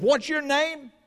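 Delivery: a general's indignant question. A narrator's reading. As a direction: bristle at the soldier's answer. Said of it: "the Great was indignant".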